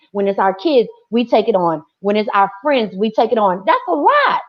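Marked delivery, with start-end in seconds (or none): 3.6-4.4 exasperated. Real, not acted. Exasperated about burdens.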